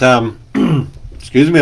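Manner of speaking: throat catching between words; coughing. Real, not acted. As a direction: clear the throat, then excuse yourself. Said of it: "I'm coughing".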